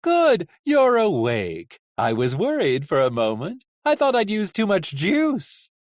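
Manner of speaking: high, loud, theatrical delight; cheerful. A narrator's reading. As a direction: greet the captive with showy good cheer. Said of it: "a cheerful voice said".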